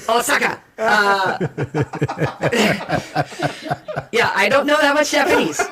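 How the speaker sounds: high laughing admission; embarrassed laughing. Real, not acted. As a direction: laugh through the words. Laughing at own Japanese.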